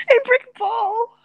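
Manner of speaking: laughing. Real, not acted.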